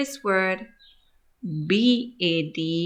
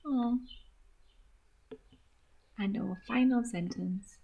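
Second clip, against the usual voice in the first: ordinary talk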